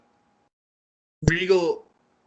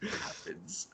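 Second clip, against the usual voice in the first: slow hushed whisper, much lower and softer; dramatic whisper; 16 dB quieter than usual. Acted. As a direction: whisper it like a secret reveal. Building suspense for the return.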